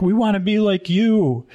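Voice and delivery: high-pitched imitation of children; kids' chorus. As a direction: imitate admiring kids pleading together. Adoring kids speaking in chorus.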